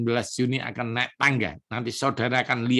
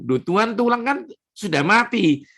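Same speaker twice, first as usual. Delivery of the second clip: protesting prophet; 8 semitones higher, 3 dB louder than usual. Retold or imitated. Prophet protests to God, retold.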